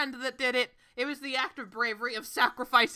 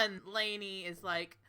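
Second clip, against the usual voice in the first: ordinary talk, nothing marked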